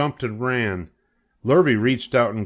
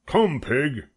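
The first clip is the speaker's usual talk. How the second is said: farmer coaxing pig; 4 semitones higher than usual. A narrator's reading. Farmer coaxes pig with pail.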